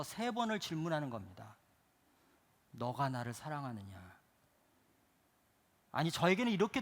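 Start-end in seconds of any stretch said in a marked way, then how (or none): 2.7-4.1 lower, quiet asking; soft question. Acted. Jesus quietly asking Peter, soft and low. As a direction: ask it gently.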